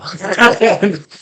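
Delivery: big, high burst of laughter; loud laugh. Real, not acted. Laughing about being sent to jail again, a big high laugh.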